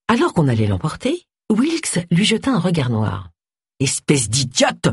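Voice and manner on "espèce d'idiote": shouting. Acted. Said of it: "cria-t-il".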